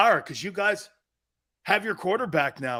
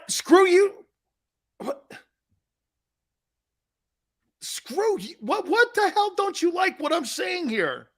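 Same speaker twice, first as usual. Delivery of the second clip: higher, put-on indignant voice; mock annoyed; 12 semitones higher than usual. Host pretending to be hurt by a colleague, mock annoyed. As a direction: play at being offended.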